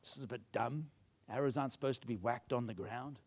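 low, subdued sheepish grumble. Acted. Reluctant king doubting the prophet's odd order, sheepish. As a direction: mutter it low, self-conscious and doubtful. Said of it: "maybe he's feeling a bit sheepish".

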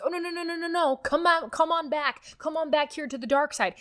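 high, coaxing voice of the enemy luring you back; pleading enemy voice. Acted. The enemy coaxing a new tither back to the dark side, high pleading voice. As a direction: beg them to come back to the dark side, playfully.

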